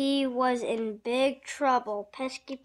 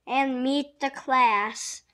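loud, higher, welcoming call; smiling invitation; 3 semitones higher, 8 dB louder, slower than usual. A narrator's reading. Miss Cherry bringing Jake to class, loudly welcoming. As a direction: invite with a big audible smile. Teacher welcomes new pupil.

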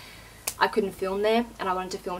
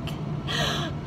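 sharp breathless gasp of delight; thrilled intake of breath; 5 dB quieter than usual. Real, not acted. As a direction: gasp as if seeing it for the first time. Seeing her new hair colour.

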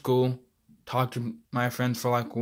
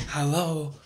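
usual speaking voice

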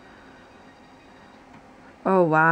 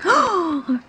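retold gasp; 9 semitones higher than usual. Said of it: "and I was like".

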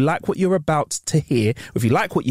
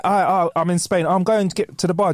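ordinary talk, nothing marked

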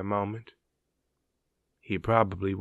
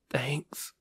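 soft, tight whispered thanks; choked whisper; 3 semitones higher, 6 dB quieter than usual. Acted. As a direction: barely whisper it, holding back tears. Ace moved, near tears.

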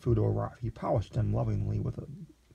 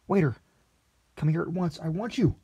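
high, loud summons across the room; calling out; 6 semitones higher, 4 dB louder than usual. A narrator's reading. Lexington summoning the waiter across the restaurant, loudly. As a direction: call the waiter over at once. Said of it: "calling waiter across room".

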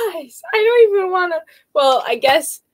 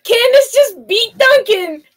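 high, loud gasp of disbelief; real shocked squeal; astonished excitement; 7 semitones higher, 5 dB louder than usual. Real, not acted. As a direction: shout it out, amazed at the new record. A donation tops the record.

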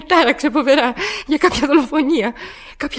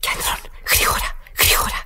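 hurried frightened whisper; urgent whisper; 6 dB quieter than usual. Acted. Woman begs the operator for help, urgent whisper.

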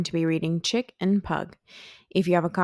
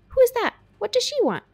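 very high, louder startled chick; alarmed chick; 5 dB louder, faster than usual. A narrator's reading. Chick startled by footsteps of a stranger. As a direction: squawk it in sudden alarm.

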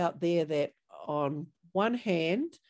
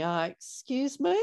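offended "Ah, excuse me" pitched higher; huffy, affronted pushback; a hint of bristling offence; indignant tone; 7 semitones higher than usual. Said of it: "putting a tone in your voice".

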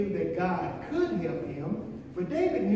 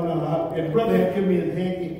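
ordinary delivery

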